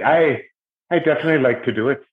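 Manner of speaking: quick, badly faked Irish accent; rushed hammy Irish lilt. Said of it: "that was a bad Irish accent".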